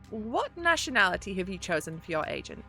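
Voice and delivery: ordinary talk, nothing marked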